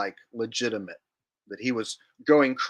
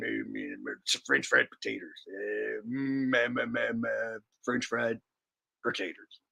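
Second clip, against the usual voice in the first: Sling Blade impression; 3 semitones lower, 6 dB quieter than usual. Shown as a demonstration. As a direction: stammer it out low like the Sling Blade guy. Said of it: "I'm gonna do my Sling Blade impression".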